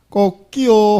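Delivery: loud mock rooster crow. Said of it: "하고 닭이 울은 거예요". (수탉 울음 흉내)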